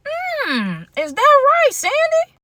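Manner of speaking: high, sing-song mock disbelief; sarcastic tease. Real, not acted. Host mockingly calls out Sandy for lying, high sarcastic sing-song. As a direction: needle her with a sky-high "is that right".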